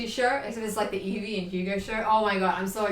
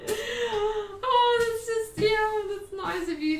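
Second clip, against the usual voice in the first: touched; 7 semitones higher, 4 dB louder, slower than usual. Real, not acted. Touched by viewer offer.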